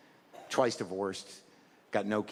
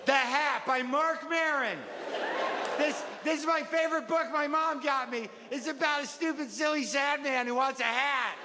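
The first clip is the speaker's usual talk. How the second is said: put-on higher-pitched, singsong kid telling a favorite story; eager child reciting storybook; 9 semitones higher than usual. Child shows off the book mom got them, earnest kid voice. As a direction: recount it like an earnest little kid, judging the silly man.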